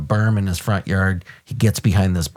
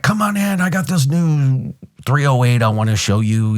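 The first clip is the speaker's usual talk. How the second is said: high, pitched-up imitation of the drunk guy; eager drunk invite; 6 semitones higher than usual. To the officer at his door, a high mock drunk invite. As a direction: mimic the drunk neighbor waving him inside.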